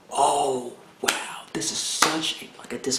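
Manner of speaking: soft, breathless whisper of amazement; hushed awe; awed whisper. Real, not acted. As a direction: whisper it, stunned. Stunned by the revelation.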